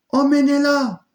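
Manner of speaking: very high, loud shouted order; shouting. Acted. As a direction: scream the order to the guards.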